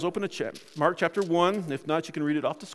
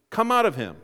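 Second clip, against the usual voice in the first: louder, higher commanding tone; Jesus, stern; 4 semitones higher, 6 dB louder than usual. A narrator's reading. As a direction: firmly order it out. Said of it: "said Jesus sternly".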